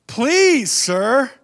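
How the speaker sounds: thrilled high please; excited please. Shown as a demonstration. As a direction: burst out with eagerness. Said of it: "See the difference?".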